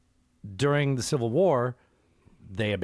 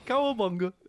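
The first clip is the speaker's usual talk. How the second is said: cartoon voice; 11 semitones higher than usual. Cartoon voice bit.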